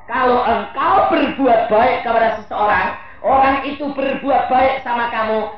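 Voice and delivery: high, loud proclaiming; preaching at full force. Acted. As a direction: declare it to the hall.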